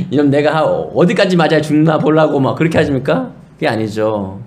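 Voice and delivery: ordinary talk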